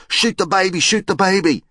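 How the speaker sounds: put-on high, eager kids' shouts; acted clamour of excited children; excited shouting. A narrator's reading. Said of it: "soon they were all shouting".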